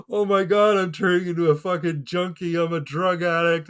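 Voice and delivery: high-pitched, panicky inner monologue; paranoid. Retold or imitated. Teen thinking he's becoming an addict, paranoid. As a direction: act out a spiralling inner freak-out. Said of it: "very paranoid".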